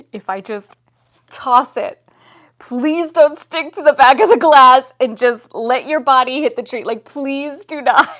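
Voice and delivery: loud, high pleading; desperate plea. Retold or imitated. Begging the spider not to cling to the glass. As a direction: beg it loudly, near panic.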